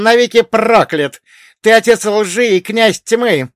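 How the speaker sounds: high, loud raging tirade; hysterical furious yelling. A narrator's reading. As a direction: hurl it out in hysterical rage. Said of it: "выкрикивал эти слова, истерически смеясь".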